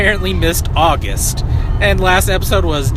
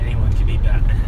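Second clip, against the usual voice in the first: gravelly Batman voice. Pretend. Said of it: "You just have to have a real gravelly voice".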